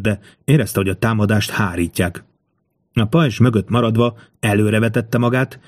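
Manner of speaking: usual speaking voice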